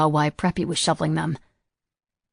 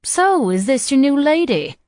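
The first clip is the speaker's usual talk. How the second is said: put-on higher Southern old lady, playfully scolding; genteel old lady; 8 semitones higher, 6 dB louder, slower than usual. A narrator's reading. Arlene teasingly scolding Samuel, higher old lady voice. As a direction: wag a finger at him with a smile.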